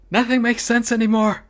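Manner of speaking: high, loud outburst; exasperated shout. Real, not acted. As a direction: yell it in disbelief. Exasperated outburst at gacha results.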